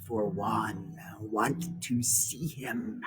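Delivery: unvoiced, slow hissing whisper; whispered snake. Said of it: "said the snake".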